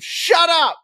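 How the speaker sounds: retold scream. Yelling at a drunk heckler to shut up, shouting. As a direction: bark it at the heckler, high and loud. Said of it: "I just screamed".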